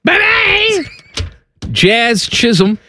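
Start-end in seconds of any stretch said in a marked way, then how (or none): 0.0-0.8 very high squealed goodbye; mock the goodbye in a squeaky sustained pitch; silly high sign-off. Mocking sign-off in German character voice.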